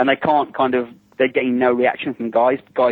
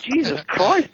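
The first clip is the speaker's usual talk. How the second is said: high, startled mock exclaim; mock shocked blurt; stunned exclamation; 10 semitones higher, slower than usual. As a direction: react as if deafened. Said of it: "I'm like".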